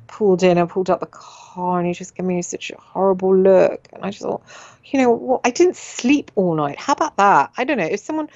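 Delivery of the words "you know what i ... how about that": indignant retort. Retold or imitated. Indignant retort to the glaring neighbour.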